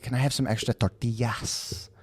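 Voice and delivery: put-on overdone Spanish accent on tortillas; mock Spanish accent; fake Spanish accent. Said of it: "people who are like, don't speak another language".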